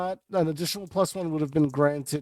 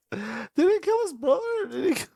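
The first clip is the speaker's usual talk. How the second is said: high, panicky sobbing questions; mock panicked crying; 13 semitones higher, 3 dB louder than usual. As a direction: blubber the questions in a fake panic. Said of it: "freaking out and crying".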